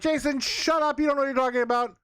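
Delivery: high, scoffing fan voice; annoyed viewer. Pretend. Viewer who loved the movie telling the reviewer off, scoffing and high. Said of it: "and you're like".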